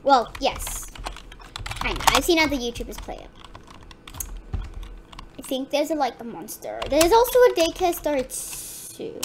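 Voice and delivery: usual speaking voice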